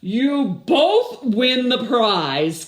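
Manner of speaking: a loud, slow, high proclamation; proud mayor announcing. Acted. The mayor hands the winners their prize, loud, slow, grand announcement. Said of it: "said the mayor".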